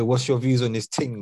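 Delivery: usual speaking voice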